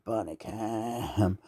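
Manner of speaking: a soft, slow echo of the name. Acted. Echoing the bunny cam name softly to herself, slow. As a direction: murmur it back slowly.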